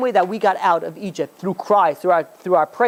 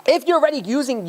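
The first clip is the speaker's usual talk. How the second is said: exasperated urging; 7 semitones higher than usual. Retold or imitated. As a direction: shake them out of it. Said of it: "I'm like".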